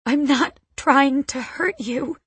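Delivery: high-pitched, sobbing protest; tearful plea. A narrator's reading. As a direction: plead with her through tears. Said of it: "I whisper, sobbing".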